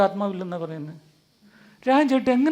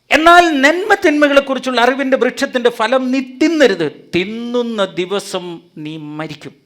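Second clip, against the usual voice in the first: loud, higher command, slowing to a grave end; solemn voice of God; 6 semitones higher, 9 dB louder than usual. Acted. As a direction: pronounce the warning like God. Reading God's command from scripture. (ദൈവത്തിന്റെ ഗൗരവമുള്ള കല്പന)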